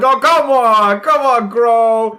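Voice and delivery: high, loud coaxing crows; crow chorus. Acted. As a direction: coax him along as a flock. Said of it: "all of them in unison are like".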